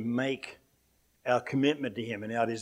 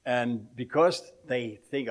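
ordinary talk

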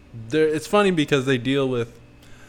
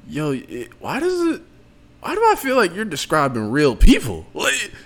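incredulous; 2 semitones higher, 5 dB louder than usual. Retold or imitated. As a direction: pitch jumping up in disbelief. Acted own reaction while watching.

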